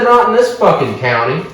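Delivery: loud, high retold comeback; defiant retort. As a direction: re-enact arguing back at a cop. Retold defiant argument with cop.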